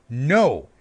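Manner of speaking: a loud, flat-out refusal; defiant no. Retold or imitated. Jake defying Neytiri's order, loud and stubborn. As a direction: plant your feet and refuse.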